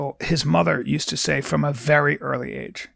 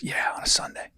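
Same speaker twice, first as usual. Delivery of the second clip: whispered reply; 8 dB quieter than usual. Retold or imitated. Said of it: "He goes".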